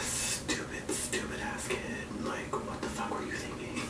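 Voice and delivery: hushed, slow whisper at her younger self; muttered self-reproach in a whisper; whispered self-scolding. Real, not acted. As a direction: whisper it under your breath, exasperated.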